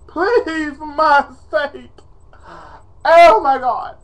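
high, drawn-out melodramatic begging; dramatics. Pretend. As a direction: beg with hammed-up desperation, then cry out. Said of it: "enough of my dramatics".

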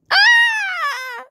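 very high, far-off shriek of fright; terrified scream. Pretend. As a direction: scream as the house burns. House on fire, zombie panic.

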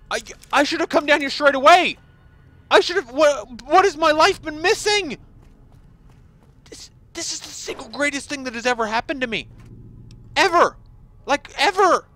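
high, loud, breathless gushing; ecstatic gushing. Real, not acted. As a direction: overflow with joy. Gushes over the loot.

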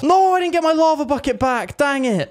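high-pitched, exasperated wail; frustrated outcry. Real, not acted. Lost his lava bucket.

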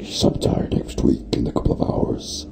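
very low, soft whispery rumble; deep, voiceless trailer growl; movie voiceover. Pretend. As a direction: drop to a hushed cinema announcer voice. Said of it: "sounds like the movie voiceover guy".

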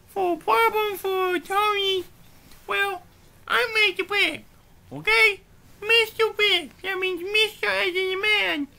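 lightly voiced cartoon pig boss; a touch of swagger, piggy character voice; self-important pig. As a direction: hold forth as a pompous puppet pig.

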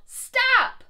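high, loud shouted "Stop!"; teacher demonstrates the yell for the children, loud shout. Shown as a demonstration. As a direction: show the kids how to yell it.

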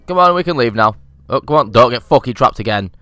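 normal talking voice

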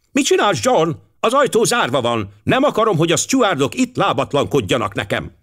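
high-pitched, nagging scold; sharp, scolding wife. A narrator's reading. Wife to husband trying the locked cabin door, sharply scolding. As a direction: scold him in a high, cutting voice.

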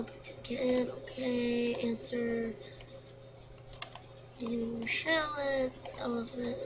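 ordinary delivery

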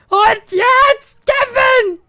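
fed-up player shouting at sim Kevin; angry shout. Acted. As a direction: scream his name in fury.

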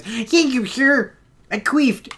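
squeaky high grateful private; high mock soldier. Soldier thanking his commander in a high silly voice. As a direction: answer the sergeant in a high silly voice.

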